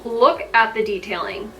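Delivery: normal talking voice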